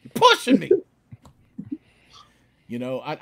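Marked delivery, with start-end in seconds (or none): 0.0-0.7 loud, high furious tough-guy outburst; shouting hammed-up gangster fury; Joe Pesci rant. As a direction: blow up like a hothead gangster. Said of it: "I turn into Joe Pesci from Goodfellas".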